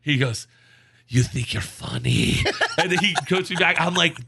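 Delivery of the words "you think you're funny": slow, drawn-out put-on caller voice; slow mock-accented retort; offended scam caller. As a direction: imitate a caller taking offence, word by word.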